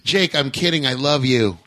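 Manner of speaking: normal talking voice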